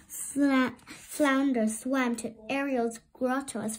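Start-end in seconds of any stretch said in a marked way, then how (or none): none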